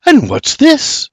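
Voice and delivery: high, slow, puzzled ask; surprised question. A narrator's reading. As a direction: ask as if something is missing from the table. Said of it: "Surprised not to see any birds".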